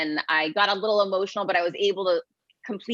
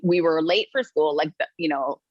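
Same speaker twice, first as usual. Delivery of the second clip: usual speaking voice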